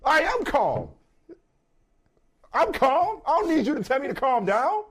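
high, loud mock shout; insisting I'm calm while yelling; mock turning up. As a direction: take it up a notch, hammed-up. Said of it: "Now I got to turn up".

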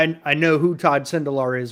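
ordinary talk, nothing marked

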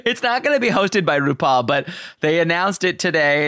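ordinary talk, nothing marked

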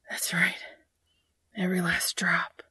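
soft breathed murmur; breathy whisper. A narrator's reading. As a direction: breathe it softly. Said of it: "Dean breathes".

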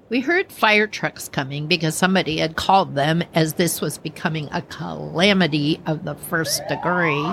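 normal talking voice

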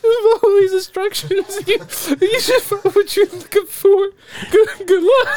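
high shopkeeper voice. Acted. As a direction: squeak it out through laughter. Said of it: "Try that again".

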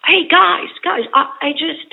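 a light go at Jeff Bridges calling to the cast; Jeff Bridges impression. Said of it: "this is my Jeff Bridges impression".

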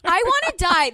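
high, loud mock despair; mortified outburst. Real, not acted. Cohost mortified over her ad mistake, loud wail. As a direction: wail it in embarrassment. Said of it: "mortified about mispronunciation".

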